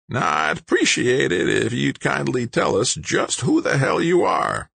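high, raspy demand; rasping old man. A narrator's reading. Old man questioning the intruder, high rasping voice. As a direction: rasp it high, leaning hard on the question. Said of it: "rasped the old man".

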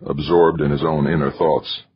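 plain reading voice

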